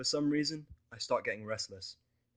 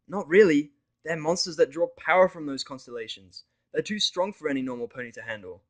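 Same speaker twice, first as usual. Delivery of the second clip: higher, louder explaining mare; Vinyl explaining; 5 semitones higher, 10 dB louder than usual. Acted. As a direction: spell out the danger. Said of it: "she explains".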